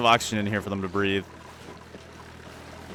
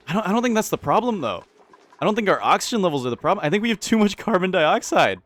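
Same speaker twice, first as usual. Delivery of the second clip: excited; 9 semitones higher, 4 dB louder, faster than usual. Real, not acted. Urgent argument over the air problem.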